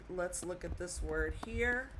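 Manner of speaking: ordinary delivery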